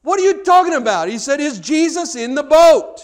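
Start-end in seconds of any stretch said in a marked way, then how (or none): 0.0-1.1 high, loud, incredulous outburst; exasperated. Retold or imitated. Young preacher baffled by the missionary's question, exasperated. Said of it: "the young preacher was so exasperated". 1.4-3.0 loud, high, drawn-out question; emphatic repeat. Retold or imitated. Old missionary repeating his question to the exasperated preacher, emphatic.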